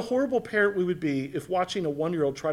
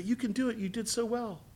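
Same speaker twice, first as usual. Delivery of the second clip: put-on encouraging a toddler; 5 semitones higher, 10 dB quieter than usual.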